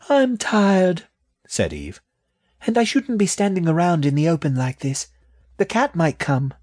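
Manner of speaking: put-on high, tired childlike voice; a sleepy, wary young rat; little rat voice. Eve tired, worried.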